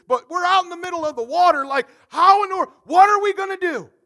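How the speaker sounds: high, loud dismay over the missing dinner; panicked disciples. Acted. Disciples in the boat, loudly panicking over bread. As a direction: throw up your hands, we forgot the food.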